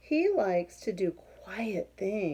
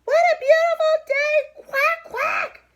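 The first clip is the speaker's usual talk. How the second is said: much higher, loud quacking; shouting duck; 13 dB louder than usual. Acted. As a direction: blast out the quacks like a noisy duck. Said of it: "shouts Dottie".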